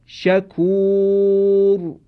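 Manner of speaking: شکور drawn out very slowly, the long او leading into a heavy ر; heavy Quranic r. Shown as a demonstration. Teacher gives an example of the heavy r after long u. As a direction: recite شکور very slowly, ending on a thick ر.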